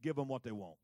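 quiet and settled, like God speaking; God's calm verdict. Acted. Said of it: "Watch what God says to Samuel".

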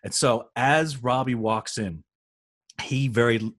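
ordinary talk

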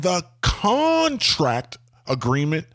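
"contract agreement" with a long, stretched CON; over-the-top emphasis. Shown as a demonstration. Owner correcting a contractor, heavy slow stress on "contract agreement". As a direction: slowly hammer out "contract agreement". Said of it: "put emphasis on the word contract agreement".

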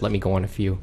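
quiet, sheepish self-quote; trailing off. Retold or imitated. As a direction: mutter it back to yourself. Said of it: "I said".

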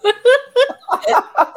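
a long high rising horn blast done with the voice; imitated horn call. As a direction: mimic the alien horn, high and loud. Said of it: "That was beautiful".

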